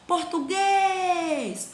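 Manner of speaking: português called out high, GUÊS held very long; calling the word. Shown as a demonstration. Teacher calls the word for a pupil, GUÊS held long and high. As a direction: call out português, stretching its last syllable GUÊS. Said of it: "Então chama a palavra".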